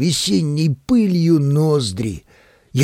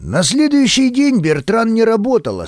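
plain reading voice

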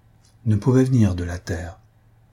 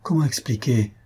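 plain reading voice

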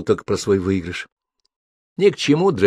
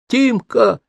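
put-on very high, joyful child's call; delighted; 4 dB louder than usual. A narrator's reading. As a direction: cry out the friend's name in delight. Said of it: "обрадовался он". (радостный детский возглас)

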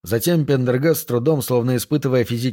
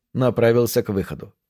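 ordinary delivery, nothing marked

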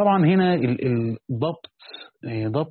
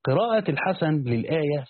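ordinary delivery

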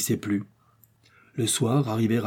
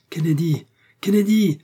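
high urgent call, building louder; crying out; 7 semitones higher, 4 dB louder than usual. A narrator's reading. Doctor calls his friend before the crash, crying out. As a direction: call the name, then raise it further. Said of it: "s'écria le docteur".

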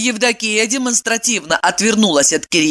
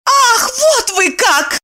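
high, drawn-out indignant yell; angry roar; slower than usual. A narrator's reading. Woman leaving the room offended, angry yell. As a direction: snap back, stretched and way up in pitch. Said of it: "рявкнула Евдокия".

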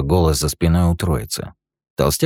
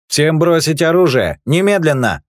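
high, loud order; commanding shout; 11 semitones higher, 5 dB louder than usual. Acted. Guard storms in ordering everyone to disarm, loud. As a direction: bark it like station security.

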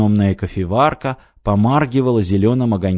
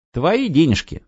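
ordinary delivery

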